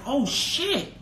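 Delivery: high-pitched, stunned reaction; incredulous, high exclamation; retold shock. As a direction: re-enact being taken aback, voice way up. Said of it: "I was like".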